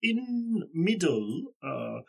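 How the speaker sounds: high, slow broken-English greeting; halting foreign villager. Acted. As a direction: feel out each word like a villager with little English. Plays local man with little English.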